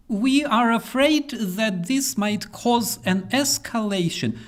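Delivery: high sarcastic whine; mock timid officials. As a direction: parody scared Western politicians. Mocking Western officials' excuse.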